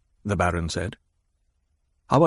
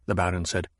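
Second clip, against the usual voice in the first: plain reading voice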